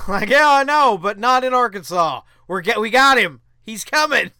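loud triumphant insistence; excited. Real, not acted. Host answering doubters, loud and triumphant. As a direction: boast that we got him.

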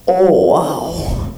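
low, drawn-out dying grandmother; dying grandma's outburst. Retold or imitated. Dying grandma seeing a picture on the phone, low feeble outburst. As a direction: imitate a dying woman's last low exclamation. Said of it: "she just yells out".

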